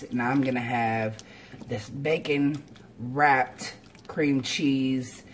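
usual speaking voice

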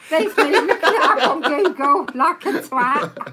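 loud, high-pitched laughing complaint. Real, not acted. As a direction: crack up at being fooled, loudly. Laughs at being fooled.

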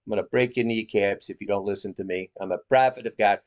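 put-on New York accent. Commentator mimicking a self-styled prophet, mock New York accent. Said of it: "Anyway, the New York accent".

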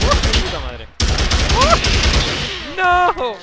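high shocked no; dismayed cry. Real, not acted. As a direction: cry out as you die. Killed in game.

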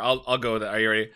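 normal talking voice

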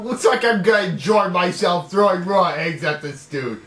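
ordinary talk

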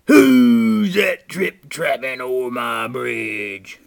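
slow, very loud troll roar; shouting troll. A narrator's reading. Troll challenges Great Big Billy, slow roaring shout. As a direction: roar it slowly, stretching WHO'S.

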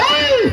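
high, loud "hey" cry; sharp rise in pitch on one cry; urging yell. Real, not acted. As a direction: yell high to drive the team on. Urging on the racing team.

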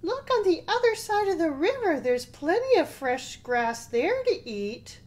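high, quick little goat; eager tiny goat pointing at grass. Acted. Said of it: "said the littlest billy goat".

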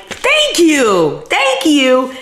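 high gushing thank-yous, lightly put on; imitating her thanks. Acting out her own reaction to her cousin's note, high gush. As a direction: gush it up high, mock overwhelmed. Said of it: "I'm just like".

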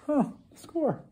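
high pleased little win; excited. Real, not acted. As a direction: celebrate the find. Finding the screwdriver.

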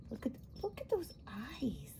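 hushed whisper in awe. Real, not acted. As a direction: breathe it softly, amazed. Admiring spider up close.

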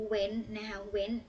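went said very slowly and clearly; careful classroom demo; slow modelling. Shown as a demonstration. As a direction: drag out each went for pupils to repeat. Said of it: "คำนี้".

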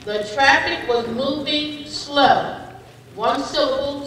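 usual speaking voice

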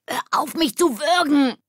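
high, loud, choked protest; strangled croak. A narrator's reading. Ole begging Lilly to let go, croaking loudly. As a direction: croak out a shout while being throttled.

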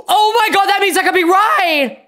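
high, loud thrilled squeal; shrieking jackpot winner. Real, not acted. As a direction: scream it like you won. Realizing she may be right.

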